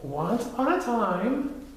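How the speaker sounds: high, slow storyteller voice; small voice, drawn out; Piglet starting a story. Acted. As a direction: begin the tale timidly and slowly. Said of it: "He began the story".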